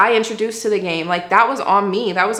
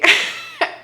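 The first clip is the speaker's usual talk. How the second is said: a real burst of high laughter; high-pitched laugh. Real, not acted. Laughing at herself while telling the listener the story.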